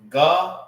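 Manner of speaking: "ga-ran" split into two slow syllables, GAH then RAHN; "ga-ran" syllable by syllable with a pause between; Hebrew pronunciation. Shown as a demonstration. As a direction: sound out "ga-ran" slowly, ah in both halves. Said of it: "how you say throat in the Hebrew".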